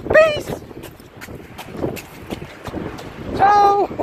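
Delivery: high taunting yell; shouting goodbye. Real, not acted. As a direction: yell it back over your shoulder. Shouting goodbye while fleeing.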